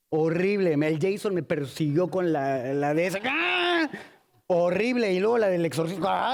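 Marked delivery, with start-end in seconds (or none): 3.2-3.9 high, drawn-out "aah"; imitated scream. Haunted-house customer chased by Jason, imitated high scream. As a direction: let out a high mock scream.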